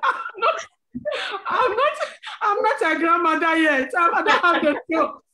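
high, loud, laughing denial; laughing protest. Real, not acted. Mother laughing off co-host's joke about babies. Denies being a grandmother, laughing.

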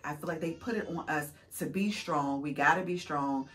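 usual speaking voice

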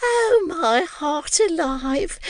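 high, slow gushing landlady voice; affected joy, very high and drawn out; gushing Mrs Mann. A narrator's reading. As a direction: overdo the delight, sugary and shrill-high.

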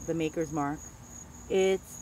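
ordinary talk, nothing marked

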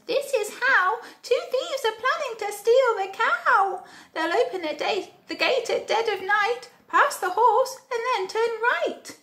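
tiny high-pitched bug voice; very high little creature voice, held all through; little ladybird. A narrator's reading. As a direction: squeak out the warning like a small ladybird. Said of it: "the little spotty ladybird ... told the animals".

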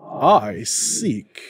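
loud indignant exclamation; the spirit exclaiming in outrage; exclaimed. A narrator's reading. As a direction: burst out in protest. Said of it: "exclaimed the spirit".

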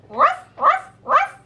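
high playful ruff ruff barks; dog barking. Pretend. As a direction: bark like a puppy for the kids. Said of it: "bark like a dog".

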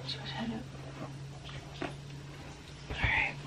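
hushed soothing whisper. Real, not acted. Soothing sick child quietly.

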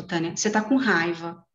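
ordinary talk, nothing marked

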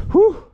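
sharp, high, loud blow of relief; loud relieved whew. Real, not acted. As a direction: puff it out after the hard climb. Exhale at top of climb.

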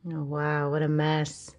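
ordinary delivery, nothing marked

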